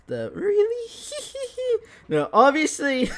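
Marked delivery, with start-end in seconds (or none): 0.3-1.8 much higher Really, then a sly evil giggle. Retold or imitated. Retelling girlfriend's sleep laugh as a sly Loki, evil giggle. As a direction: giggle like a cheeky trickster. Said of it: "Evil laugh".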